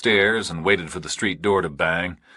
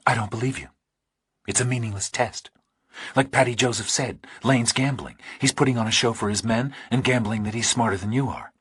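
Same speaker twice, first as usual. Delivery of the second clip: a hint of a woman's voice, higher, cool and doubting; lightly voiced Pauling; 4 semitones higher than usual. A narrator's reading. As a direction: dismiss him, a little higher.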